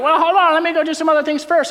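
whiny putting-off; mock excuses. People stalling Jesus.

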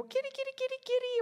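very high, coaxing pet call; high little repeated cat call; calling a kitten. Retold or imitated. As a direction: call the kitty in squeaky falsetto.

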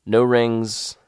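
usual speaking voice

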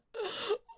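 a sharp gasp with a sob in it; tearful gasp. Retold or imitated. Learning she's pregnant, gasping into tears. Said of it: "hey, the crying".

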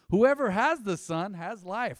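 loud, slow proclamation; full-voiced preacher read; preaching. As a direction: ring it out to the room. Proclaims scripture at full force.

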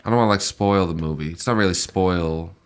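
ordinary delivery

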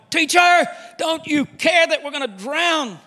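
high, loud frantic cry; panicked shout. Acted. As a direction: yell it over the storm, desperate. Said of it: "woke him up shouting".

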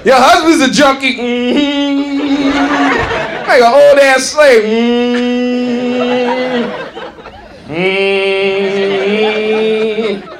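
hammed-up sassy hum; mock Montel. Comic imagining Montel scolding her, sassy. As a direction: put on attitude, hum it. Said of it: "Montel would have been like".

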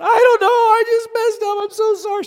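put-on high, loud little girl's voice. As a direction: play the sorry little girl near tears. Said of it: "And she just said".

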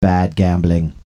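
low, stiff robot voice. Shown as a demonstration. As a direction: say it like a machine. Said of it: "should maybe be done in a robot voice".

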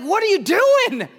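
hammed-up divine exasperation; God fed up with sinners, mock scolding; frustrated God. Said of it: "He just gets mad".

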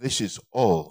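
low, soft, fading retold reply; quietly retold heartbreak, dying away; broken voice. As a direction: imitate her broken voice, sinking to almost nothing. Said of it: "with a broken voice she said".

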